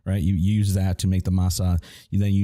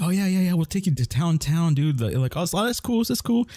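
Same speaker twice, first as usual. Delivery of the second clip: mock eager teachers; 9 semitones higher than usual. Teachers inviting him downtown.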